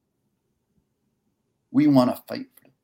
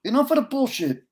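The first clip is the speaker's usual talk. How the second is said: much higher and louder, pleading; impassioned plea; 6 dB louder than usual. Real, not acted. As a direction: pour out the feeling to the audience. Emotional plea after the story.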